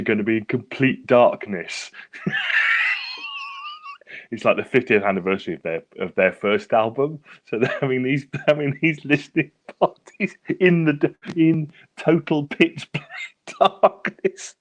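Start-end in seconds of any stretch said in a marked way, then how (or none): 1.9-3.9 long high shriek of laughter. Real, not acted. Hearing about listening parties in darkness, shrieking laugh. 8.5-14.5 laughing through words. Real, not acted. Laughing while telling news.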